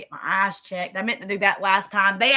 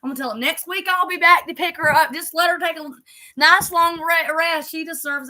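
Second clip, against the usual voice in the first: higher, playful imitation of her husband; wife imitating her teasing husband, higher and playful; 6 semitones higher, 4 dB louder than usual. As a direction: mimic her husband's joking plan, pitched up. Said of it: "He's like".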